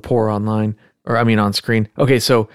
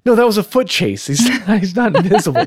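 higher, laughing disbelief; incredulous; 9 semitones higher than usual. Retold or imitated. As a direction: scoff at the absurd claim.

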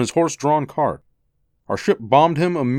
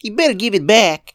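put-on high boy's voice, loud warning; threatening child; 8 semitones higher, 5 dB louder than usual. Boy demands his toy back, high angry warning. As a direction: warn him like an angry kid.

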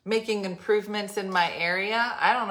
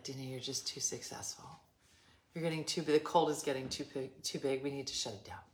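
imagined conspirators; 6 semitones lower, 11 dB quieter than usual. Pretend. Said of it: "They were like".

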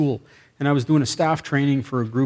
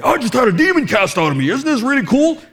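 high, loud, hammed-up excitement; thrilled gushing; 11 semitones higher, 6 dB louder than usual. Delivered man telling the secretary, gushing excitedly. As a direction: imitate someone bursting with amazing news.

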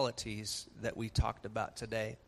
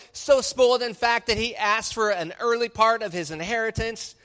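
full-force preaching; 9 semitones higher, 6 dB louder than usual. Acted. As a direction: preach it louder and higher, pressing every point.

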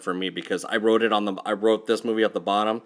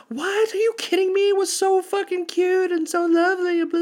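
very high incredulous gushing voice; high squealing girlfriend, shocked anyone disliked it; mock girlfriend; 4 dB louder than usual. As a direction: squeal it like a smitten ex. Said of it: "She's like".

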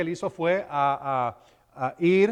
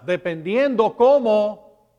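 normal talking voice

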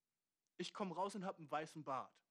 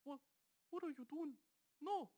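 much higher, hammed-up Indian accent; 9 semitones higher than usual. Warns viewer then attempts Indian accent, high squeaky try. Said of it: "jetzt kommt ein indischer Akzent".

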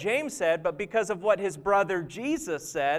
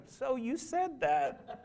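quiet, slow, knowing reproach; Pastor Dwight's reproach; 3 semitones higher, 9 dB quieter, slower than usual. Retold or imitated. Colleague teasing the pastor on Monday: quiet, slow reproach. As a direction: softly needle the preacher, slowly. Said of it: "Pastor Dwight on a Monday morning".

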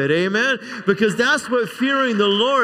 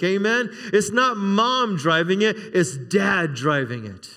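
ordinary delivery

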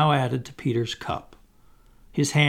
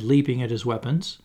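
plain reading voice

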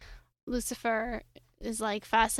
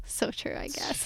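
laughing whisper; 3 semitones higher, 6 dB quieter than usual. Real, not acted. Laughing aside to co-hosts.

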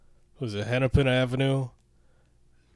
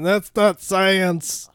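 high, loud, drawn-out mock outrage; 9 semitones higher, 9 dB louder, much slower than usual. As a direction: parody a scoffing critic slowly. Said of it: "And then people were saying".

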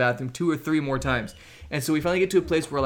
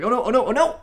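higher, louder frantic oh no chant; retold panic; 8 semitones higher, 5 dB louder than usual. Missionary reliving the accident for his podcast co-host, frantic. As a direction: spiral into panic as it happens. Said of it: "It was like, it was one of those".

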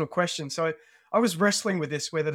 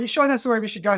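higher-pitched, mildly nervous retold question; a bit nervous; 6 semitones higher, 4 dB louder, faster than usual. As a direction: question the route as a slightly nervous teenager. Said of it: "starting to get a bit nervous".

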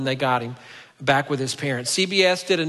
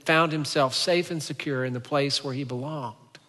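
usual speaking voice

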